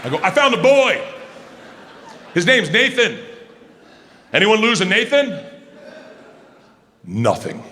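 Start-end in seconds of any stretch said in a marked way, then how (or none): 0.2-5.4 raised voice calling to a crowd. Retold or imitated. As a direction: announce it across a packed party.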